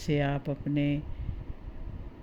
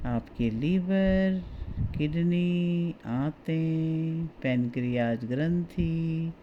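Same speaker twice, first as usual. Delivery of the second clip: guided meditation. Acted. Guide talks listener through relaxing the body for sleep, guided meditation. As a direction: ease the listener towards sleep, stretching key words.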